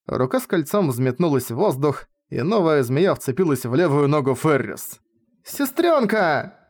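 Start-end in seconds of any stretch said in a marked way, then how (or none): 5.4-6.6 high, slow drawn-out wail. A narrator's reading. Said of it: "завопила Ирис".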